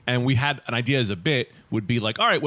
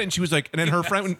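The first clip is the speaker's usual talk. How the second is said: usual speaking voice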